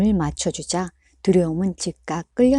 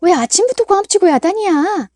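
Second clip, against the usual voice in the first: loud, higher scolding shout; mother shouting; 12 semitones higher, 7 dB louder than usual. A narrator's reading. Mother yelling at her son, loud and scolding. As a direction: shout back while running in. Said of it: "고함을 지르며".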